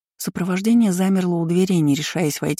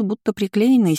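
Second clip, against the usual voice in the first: plain reading voice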